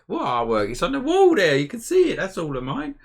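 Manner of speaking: high, loud mock-offended protest; playfully outraged, pitch shooting up; mock indignation. Mock protest at viewer's comment.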